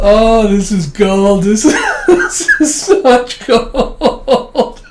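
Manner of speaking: high, loud, slow words through laughter; really cracking up while talking; helpless laughter. Real, not acted. Teller delighted by the story, laughing hard.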